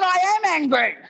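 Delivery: high-pitched, indignant mock anger; acted anger. Comedian told to look angry for a photo, acted indignation. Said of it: "I want you to look angry".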